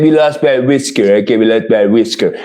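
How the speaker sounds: low, lightly voiced imitation; imitated slip-up. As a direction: drop low, as if letting it slip. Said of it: "he slipped up and said".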